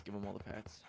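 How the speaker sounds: whisper. Real, not acted. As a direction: breathe it to the dog. Whispering to the dog.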